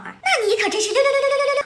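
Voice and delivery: excited. Acted. Fan slang shout.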